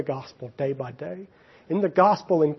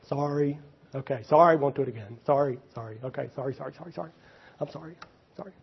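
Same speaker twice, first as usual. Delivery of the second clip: hollow apology; 6 dB quieter, much faster than usual. Acted. Preacher imitating Saul's sorry after being caught, hollow apology. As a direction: rattle off sorry after sorry, fading away. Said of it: "Saul did that".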